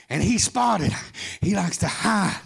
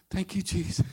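limp half-hearted praise; 3 semitones lower, 7 dB quieter than usual. Pretend. Mocks a limp churchgoer's praise.

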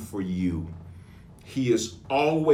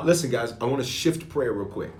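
normal talking voice

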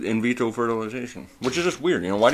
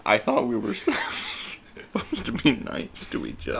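laughing; 11 semitones higher, slower than usual. Real, not acted. Laughing protest at a roast.

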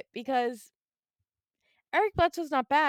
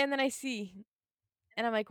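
ordinary talk

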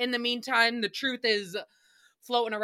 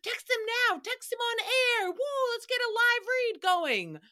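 high-pitched excited mock yelling; mock hype, pitched way up; excited urging; 13 semitones higher than usual. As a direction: urge him on in a high, hyped voice. Excitedly acting out yelling at Oscar.

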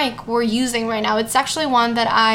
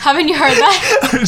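laughing; 3 semitones higher, 5 dB louder than usual. Real, not acted. Laughing at own joke.

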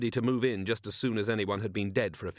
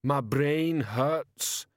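very slow dazed troll; 4 semitones higher, much slower than usual. Acted. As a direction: barely get the words out. Overheated troll speaking.

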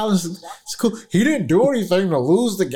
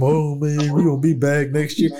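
mock dejected player; 4 semitones lower than usual. Mocking Josh Allen's worried reaction.

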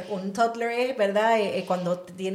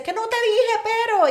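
high, loud mock-quarrel outburst; pelea de pasillo; 9 dB louder than usual. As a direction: act out snapping at someone while walking off. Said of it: "yo le digo peleas de pasillo". (pelea de pasillo fingida, a gritos y agudo)

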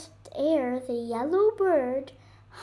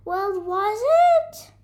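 high, louder call after the bird; 9 semitones higher, 10 dB louder than usual. Acted. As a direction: call out eagerly to the missing bird. Rocket runs calling to bird.

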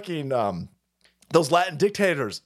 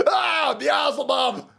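slow mock cry; 9 semitones higher, much slower than usual. Real, not acted. Shouting at game.

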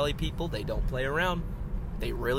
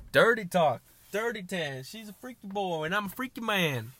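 raised-pitch imitation of a rapper's lines; 6 semitones higher than usual. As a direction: throw out the lines in a higher put-on voice. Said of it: "[name] said that".

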